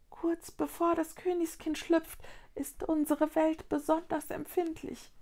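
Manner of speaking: high little voiced whisper; whispering Luni. A narrator's reading. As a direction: speak small and high, like a tiny creature. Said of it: "wisperte ein Luni".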